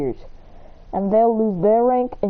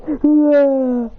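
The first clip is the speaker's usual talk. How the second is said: loud startled ah; 4 semitones higher, 6 dB louder than usual. Retold or imitated. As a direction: shout of shock, pitched up. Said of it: "He goes".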